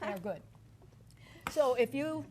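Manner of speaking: talking through a mouthful; mouth full. Real, not acted. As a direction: still chewing, mouth full. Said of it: "my mouth's full".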